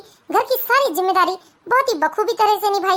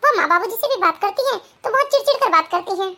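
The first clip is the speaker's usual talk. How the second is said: ordinary delivery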